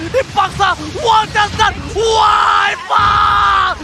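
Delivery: slow, stretched-out shouted call; hype shout. Real, not acted. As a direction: roar the winning call, drawing it out. Caster shouts winning call.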